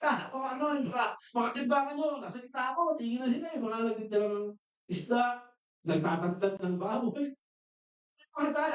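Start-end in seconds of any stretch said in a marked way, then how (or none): 0.0-3.6 retold shout. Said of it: "Sinisigaw ko". 8.4-8.7 retold shout. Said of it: "Sinisigaw ko".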